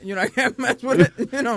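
chuckling through the words; laughing. Real, not acted. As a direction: laugh while you say it.

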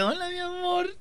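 high, soft put-on hello; soft singsong imitation; mimicked mistress. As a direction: mimic her in a small, high voice.

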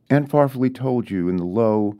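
usual speaking voice

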